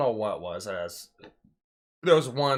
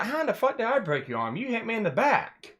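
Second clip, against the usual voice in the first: high-pitched retold protest; indignant comeback; 10 semitones higher than usual. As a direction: protest your innocence, voice pitched up. Retold indignant reply to accusation.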